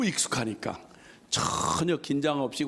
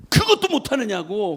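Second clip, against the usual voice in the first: mock scolding, louder and higher; 5 semitones higher, 7 dB louder than usual. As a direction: scold like he can't believe it. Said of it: "얼마나 막 야단을 치시는지". (꾸짖는 흉내)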